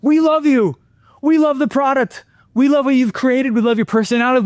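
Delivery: high, effusive praise; gushing praise. Retold or imitated. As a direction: pour on the love. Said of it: "He goes".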